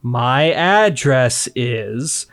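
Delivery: dragged out very slowly; mock over-slow. Shown as a demonstration. Repeating an address to a post office lady, mock over-slow. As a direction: spell it out as if to a dim listener. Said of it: "don't wanna sound like an idiot".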